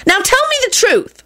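high-pitched sharp demand; threatening. A narrator's reading. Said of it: "she threatened".

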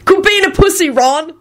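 high-pitched jeering call; yelling. A narrator's reading. Charlie teasing Ron down the table, yelling. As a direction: holler down the table. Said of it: "Charlie yelled down the table".

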